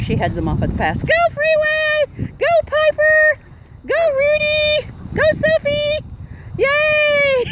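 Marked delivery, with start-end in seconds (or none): 1.0-7.5 high, drawn-out calls of go; excited cheering. Real, not acted. Owner cheers her running dogs, high and stretched. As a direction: cheer each dog on, stretching it out.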